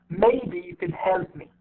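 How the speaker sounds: MAYBE and HELP long, "you could" and "me" reduced; slow on maybe and help, squeeze you could; shortened unstressed words. Shown as a demonstration. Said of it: "عم بختصرهم بشكل مختصر".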